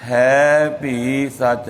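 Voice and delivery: ਹੈ ਭੀ ਸੱਚ said slowly as three separate words, ਹੈ and ਭੀ kept apart; unhurried ਹੈ ਭੀ ਸੱਚ, never run together into ਹੈਪੀ; slow, careful ਹੈ ਭੀ ਸੱਚ with a clear gap after ਹੈ; slow separated words. Shown as a demonstration. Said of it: "ਆਰਾਮ ਨਾਲ ਬੋਲਣਾ ਹੈ".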